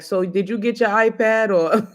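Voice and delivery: laughs mid-line, breezy; a light laughing aside; laughing casual switch. Retold or imitated. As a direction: chuckle through the topic change. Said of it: "and then we'll be like".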